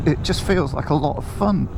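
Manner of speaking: ordinary delivery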